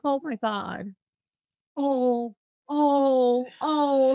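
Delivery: mock-dismayed teacher, climbing in pitch; hammed-up let-down gasps; imitating teacher's dismay. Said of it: "She was like".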